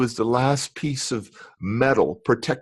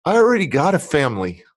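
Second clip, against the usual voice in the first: proud and triumphant; 11 semitones higher than usual. Real, not acted. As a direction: show her what he built. Proud declaration of his family.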